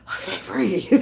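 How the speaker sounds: ordinary talk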